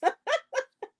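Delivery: high-pitched giggling burst; real laugh. Real, not acted. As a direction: laugh high at your own imitation. Laughs at own imitation.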